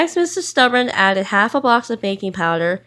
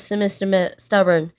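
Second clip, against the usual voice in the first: usual speaking voice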